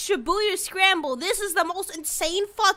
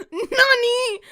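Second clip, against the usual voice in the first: mock surprise; 7 semitones higher, 8 dB louder than usual. Real, not acted. Mock Japanese exclamation.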